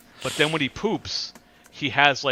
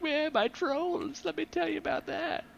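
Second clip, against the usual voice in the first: much higher, very slow and drawn out; high, dragged-out mocking whine; mock streamer voice; 4 dB quieter, slower than usual. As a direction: parody a self-pitying streamer. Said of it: "He'd be like".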